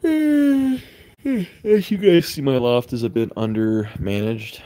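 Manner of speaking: talking through a big yawn; slow words stretched by a yawn. Real, not acted. Yawning through live stream talk.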